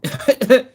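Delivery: short high disbelieving laugh; incredulous laugh; burst of laughter. Real, not acted. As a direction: laugh out loud at the clip. Reacting to clip, laughing.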